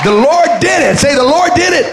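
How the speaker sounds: high, loud preacher's proclamation; real revival-meeting cry; triumphant shout. Real, not acted. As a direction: shout it for the crowd to echo. Preacher leads congregation's shout.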